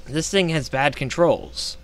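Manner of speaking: ordinary delivery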